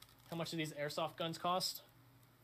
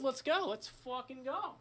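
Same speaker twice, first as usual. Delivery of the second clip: hyped up; 7 semitones higher, slower than usual. Real, not acted. Hyping himself up.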